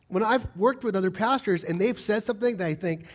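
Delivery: ordinary talk, nothing marked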